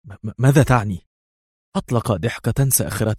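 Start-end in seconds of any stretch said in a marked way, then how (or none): 0.0-1.1 stammering fear. A narrator's reading. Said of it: "وارتجفت الكلمات على شفتيها".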